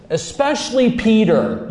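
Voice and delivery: "especially Peter" pitched high and loud; emphatic singling out. Acted. Risen Lord sending word to the disciples, emphatic on "especially Peter".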